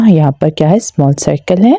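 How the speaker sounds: normal talking voice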